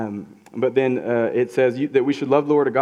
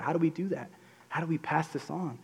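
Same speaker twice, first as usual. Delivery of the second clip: soft question; 3 semitones higher, 10 dB quieter than usual. Acted. Rhetorical questions to the church.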